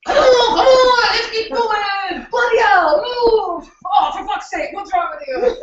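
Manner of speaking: high, loud mock English drill shout; yelling English coach. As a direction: bark like a cursing British trainer. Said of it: "So he's gonna be going".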